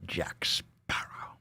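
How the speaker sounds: slow hushed whisper of a name. Acted. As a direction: breathe the name like a secret.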